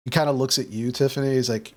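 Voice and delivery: ordinary talk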